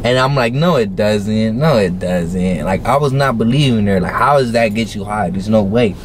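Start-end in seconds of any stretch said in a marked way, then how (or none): 0.5-2.6 very drawn-out, doubting contradiction; retold slow denial. Teen doubting the girl's claim, acted slow denial. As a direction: stretch each refusal to believe her. Retells his disbelief, drawn out.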